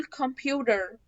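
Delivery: American "computer" as "kum-pyoo-der"; American accent. Shown as a demonstration. Teacher contrasting American with British, American accent. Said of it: "ده كده امريكان".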